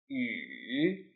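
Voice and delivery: yu dipping low then rising; third tone yu. Shown as a demonstration. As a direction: let yu sink then rise. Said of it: "Read after me".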